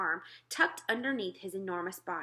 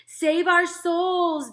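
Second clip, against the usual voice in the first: much higher, very loud slow wail; giant bellowing; 10 semitones higher, 11 dB louder, much slower than usual. Acted. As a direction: howl for help in panic. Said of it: "bellowed the flesh lump eater".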